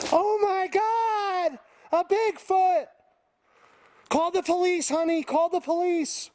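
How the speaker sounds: hammed-up clueless hiker shrieking about Bigfoot; mocking a panicked idiot. Mocking a clueless hiker who spots Bigfoot, shrill high falsetto. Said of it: "They want someone that's gonna come in here and be like".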